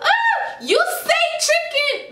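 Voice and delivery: very high squeal of disbelief; mock shocked scream. Re-enacting screaming at the food seller, high-pitched mock shock. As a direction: squeak it out as retold horror. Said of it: "When I screamed".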